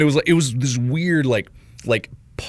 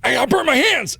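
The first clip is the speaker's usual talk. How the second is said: high whiny plea; whiny pleading; 13 semitones higher, 3 dB louder than usual. Retold or imitated. Patient pleading with the ER receptionist, high and whiny. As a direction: plead like a hurt kid at the counter.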